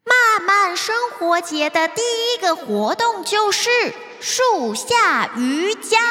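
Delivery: high, bright announcer voice; loudspeaker announcement. Acted. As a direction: announce it to the whole kingdom. Meimei announces over the loudspeaker.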